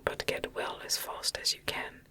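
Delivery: ordinary delivery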